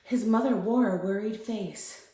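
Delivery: calm, measured bedtime-story read; story narration. A narrator's reading. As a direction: read a fairy tale gently to young children. Reads a story aloud.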